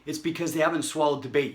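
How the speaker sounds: normal talking voice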